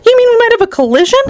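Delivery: fast, very high-pitched panic; alarmed question. A narrator's reading. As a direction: blurt it out high and quick. Mister T V alarmed at crash risk.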